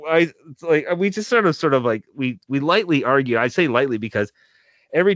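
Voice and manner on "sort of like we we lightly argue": normal talking voice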